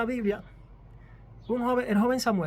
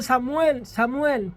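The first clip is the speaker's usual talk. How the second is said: calling out; 3 semitones higher, 7 dB louder, much slower than usual. Acted. God's voice calling again.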